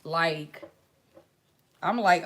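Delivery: usual speaking voice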